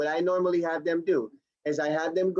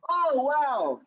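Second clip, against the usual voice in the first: acted amazement; 8 semitones higher than usual. Acts out an amazed headset user.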